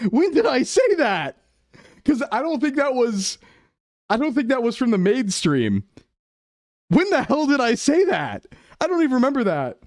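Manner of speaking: high-pitched, indignant protest; embarrassed, high-voiced questioning of the accusation; flustered disbelief. Real, not acted. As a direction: deny it all, voice pushed way up. Flustered denial of played clip.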